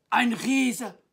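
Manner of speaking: loud, high cry of alarm; acted shout of astonishment; calling out. A narrator's reading. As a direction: exclaim as a startled storybook knight. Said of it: "rief der Ritter".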